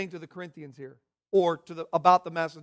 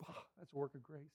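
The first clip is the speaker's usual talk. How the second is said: quiet, throwaway Oh, that's a work of grace; offhand remark; 5 semitones lower, 23 dB quieter, much faster than usual. Retold or imitated. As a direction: mutter it lightly as a hypothetical comment. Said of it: "if somebody said".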